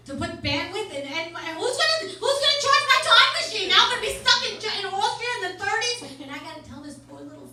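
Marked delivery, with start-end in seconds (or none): 1.6-6.0 rising rant. Acted. Ranting about time travel.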